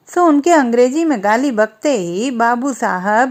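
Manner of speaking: plain reading voice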